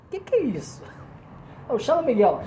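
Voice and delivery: high-pitched mock bewilderment; baffled angel. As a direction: play puzzled, voice jumping up. Baffled angel in comic skit.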